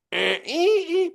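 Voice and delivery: high, held buzzer noises; imitated alarm beep. Septic inspector has the alarm tested, imitated high beeping. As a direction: mimic a tank alarm with high vocal beeps. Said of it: "hit the alarm".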